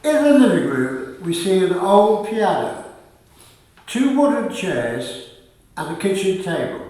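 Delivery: loud, measured scene-setting narration; stage directions read aloud. A narrator's reading. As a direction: announce the set to the audience.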